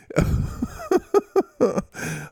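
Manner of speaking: high, long cackling giggle; helpless high-pitched laughter; laughing fit. Real, not acted. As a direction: burst out laughing at your own joke. Cracks up over tutties again.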